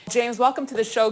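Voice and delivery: anchor voice. Real, not acted. Anchor welcomes guest to the show in a high anchor voice. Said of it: "your voice was an octave higher".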